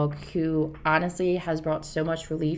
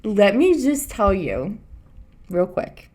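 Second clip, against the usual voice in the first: dad joke delivery; 2 semitones higher, 5 dB louder, slower than usual. Pretend.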